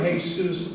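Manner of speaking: "Jesus" said as hay-SOOS; Spanish pronunciation of Jesus. Shown as a demonstration. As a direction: give "Jesus" its Spanish h sound. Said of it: "as a pronunciation".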